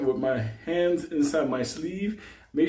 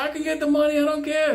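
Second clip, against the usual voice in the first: panicked hostage; 12 semitones higher than usual. Retold or imitated. Hostage promises the money.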